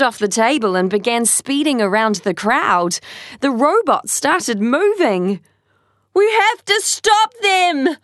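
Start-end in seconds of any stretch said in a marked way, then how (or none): 6.1-8.0 high urgent shout. Acted. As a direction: call out to stop them. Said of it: "Anna shouted to Tai".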